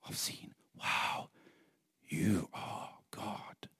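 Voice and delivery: slow, breathless whisper of wonder; hushed wonder, almost no voice; awed whisper. Real, not acted. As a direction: whisper it as if seeing God.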